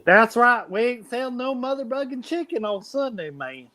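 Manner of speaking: put-on high-pitched, indignant Southern drunk; drunk Southern accent. Defending no chicken on Sunday, high drunk Southern accent. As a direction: crank up a tipsy Southern accent. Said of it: "Drunk and, uh, Southern accent".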